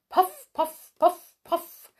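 high, punchy train puffs; puffing train. A narrator's reading. Little train arriving, high puffing noises. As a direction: imitate a little steam engine.

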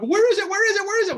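high, loud eager demand; loud impatient excitement. Retold or imitated. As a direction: pester for the package like a kid. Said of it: "I'm like".

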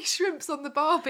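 mock Australian accent. Co-host teasing the host's slip in mock Australian accent.

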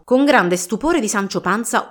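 ordinary delivery, nothing marked

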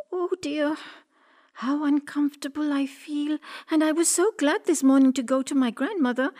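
put-on a touch of girlish unease. A narrator's reading. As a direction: fret lightly to yourself as the little girl. Uneasy girl thinks to herself.

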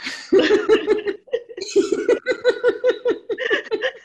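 loud high-pitched laugh; high laughter. Real, not acted. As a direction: burst out laughing at yourself. Laughs at knowing nothing of sailing. (carcajada aguda y fuerte)

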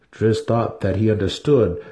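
ordinary delivery

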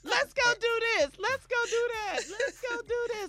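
high, pleading kid imitation; whiny kid voice. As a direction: beg like an eager child. Imitating kids' endless demands.

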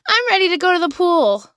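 put-on high, sweet little-kid voice; imitating a suddenly calm little boy; mock child. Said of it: "so he was like".

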